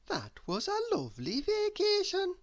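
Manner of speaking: very high, light lady's voice; pleased little prim voice, high up; prim Miss Neat. A narrator's reading. Miss Neat paying the taxi after vacation, high and light.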